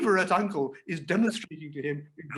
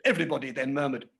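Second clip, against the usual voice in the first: usual speaking voice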